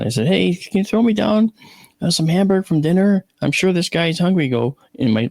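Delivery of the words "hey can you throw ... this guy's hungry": higher-pitched calling up to someone; mildly raised, acted-out holler; retold call upstairs. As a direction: call up to your son on another floor. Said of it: "I yelled up to my son".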